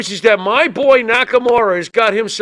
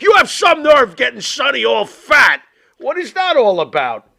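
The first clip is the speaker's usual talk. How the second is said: raised, higher-pitched mock scolding; mock outrage; 6 semitones higher, 3 dB louder than usual. Co-host joking about jail food, mock-outraged shout. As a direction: put on indignant taxpayer fury. Comic outrage over tax dollars.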